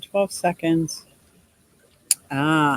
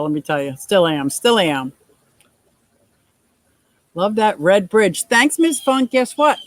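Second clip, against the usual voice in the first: ordinary talk, nothing marked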